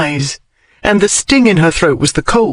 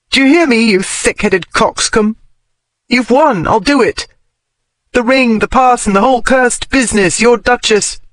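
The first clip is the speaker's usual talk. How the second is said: tearful scolding; 6 semitones higher than usual. Acted. Scolding unconscious lover.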